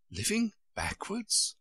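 slow, high, astonished question; great astonishment. A narrator's reading. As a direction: echo it slowly in disbelief. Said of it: "repeated in great astonishment".

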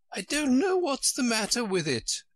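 high, mournful queen voice, lightly sad; a touch of melancholy, high-pitched. Acted. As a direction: sound forlorn in a high royal voice. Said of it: "in a melancholy voice".

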